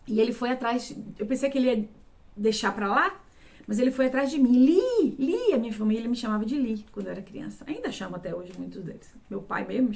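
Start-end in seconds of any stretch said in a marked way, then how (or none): 4.5-5.5 higher, louder call of a name. Retold or imitated. Man searching for the hidden girl, calling out. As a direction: call her name into the dark room, drawn out.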